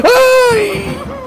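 high, loud mock call; imitating a shout. Player voicing what the enemy thinks, mock shout. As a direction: bark a sudden hey in someone's voice.

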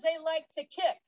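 ordinary delivery

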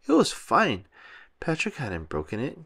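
ordinary delivery

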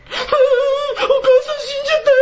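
high, loud mock wail over mother's death; high-pitched fake lament for a dead mother; mock mourner. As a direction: ham up a grieving daughter's wail. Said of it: "みたいな人は嫌だ".

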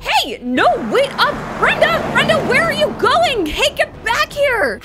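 loud, high calling out; shouting after someone. Acted. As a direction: yell after her as she runs. Chasing sister, calling after her.

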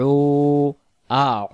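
উ pressed from the throat and held long; throaty ayn. Shown as a demonstration. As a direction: say আ, উ as "a-'uu", stretching the ayn. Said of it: "আইন অক্ষরটা সহ বলতে হলে".

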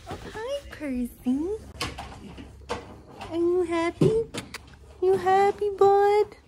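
high cooing questions to a cat; pet talk. Real, not acted. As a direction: greet a pet in a high little voice.

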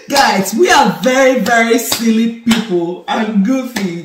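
loud, excited disclaimer; loud call-out. Real, not acted. Wife shouting to the viewers that they are goofy, loud and excited.

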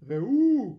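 loud, high excited shout; high fisherman's holler; calling out. A narrator's reading. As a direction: shout to your mates to look. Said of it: "קראו דייגים זה לזה".

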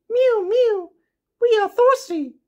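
very high, squeaky kitten chorus voice; mewing cats. A narrator's reading. Millions of cats at the pond, begging in a tiny high mew. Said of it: "cried the hundreds of cats".

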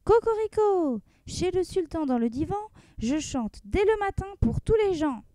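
high, proud rooster crow; cheeky rooster, pitched high. Acted. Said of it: "on entendait de nouveau".

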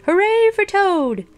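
very high, drawn-out hooray; cheering shout. A narrator's reading. Shrinking Frog cheers Toad from the audience, high cheering shout. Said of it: "as he shouted".

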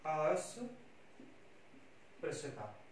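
very slow and soft, broken into parts; drawn-out quiet dictation while writing; correct spelling dictated. Shown as a demonstration. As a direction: spell it out aloud part by part, with long gaps. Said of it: "अस्पृश्यता का क्या होगा".

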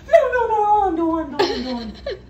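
high, loud start, easing off as it repeats; mock frantic refusal. Woman in labour refusing the wheelchair, acted-out frantic protest. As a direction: imitate a flustered refusal, high and insistent.

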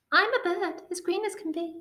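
high, squeaky talking bird; high-pitched bird asking politely; little bird voice. A narrator's reading. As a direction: pipe up high like an eager bird. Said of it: "with an ear-splitting shriek".

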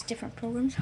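ordinary talk

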